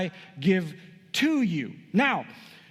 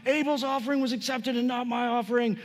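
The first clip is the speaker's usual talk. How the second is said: higher-pitched, indignant protest; mock furious; 7 semitones higher than usual. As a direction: act out Cain's indignant complaint, lightly heated. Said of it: "And he's furious".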